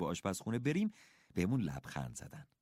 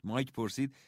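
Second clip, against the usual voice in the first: usual speaking voice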